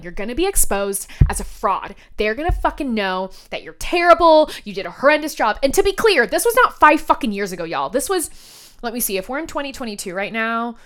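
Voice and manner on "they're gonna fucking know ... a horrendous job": louder inner critic. Retold or imitated. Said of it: "that's the feeling".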